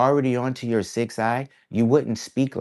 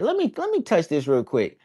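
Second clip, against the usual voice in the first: imitating the homie; 9 semitones higher than usual. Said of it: "he said".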